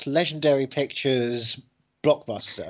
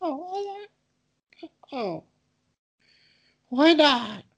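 high, wounded put-on whine; mock hurt silly voice; 7 semitones higher, much slower than usual. Comic begging his cohost for love, in a high hurt whine. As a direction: fake heartbreak in a silly voice. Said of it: "your bloody voice".

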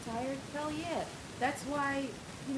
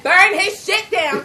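mock defiant shout; 8 semitones higher, 14 dB louder, much slower than usual.

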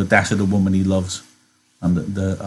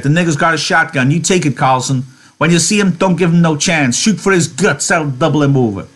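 high, loud, eager orders; fired up for the hunt; excited; 8 semitones higher, 4 dB louder than usual. Acted. Whit urges the men to shoot Lenny, excited and loud. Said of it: "Whit said excitedly".

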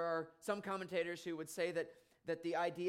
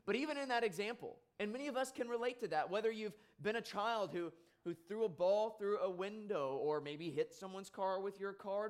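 higher, fast, pressing preacher's delivery; urgent preaching; 6 semitones higher, 4 dB louder than usual. Acted. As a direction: drive the example home to the congregation. Preaching at full force.

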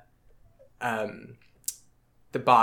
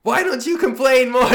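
teasing high voice; 12 semitones higher, 7 dB louder than usual. Retold or imitated. Acting the coworker doubling down.

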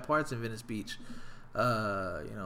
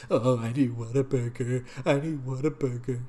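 mocking whiner; 2 semitones lower than usual. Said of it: "Quit being dramatic".

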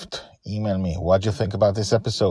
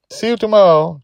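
put-on high, louder girl's farewell; young girl Joy; 13 semitones higher, 9 dB louder than usual. A narrator's reading. Joy saying goodbye to Charlie, high and bright-voiced. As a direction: sing out a child's goodbye. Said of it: "Joy says".

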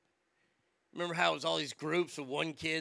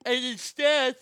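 real yawn stretching the words, louder and higher; 7 semitones higher, 9 dB louder, slower than usual. Real, not acted. Ranter loses his sentence to a yawn, yawning. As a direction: talk right through a big yawn. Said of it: "sorry, I'm yawning so much".

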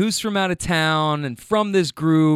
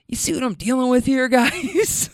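higher-pitched mock appeal to the others; exasperated aside; 7 semitones higher than usual. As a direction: say it quickly, high, as a mock complaint. Acting out grump's exasperated look.

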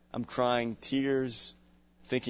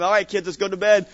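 raised parental bedtime call; 7 semitones higher, 8 dB louder than usual. Acted. Dad rounding up the kids at bedtime, calling out. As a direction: call out to the kids, higher and louder.

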